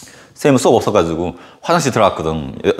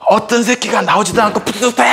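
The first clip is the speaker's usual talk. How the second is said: high angry yell; 11 semitones higher than usual. Retold or imitated. Head teacher furious at the stall.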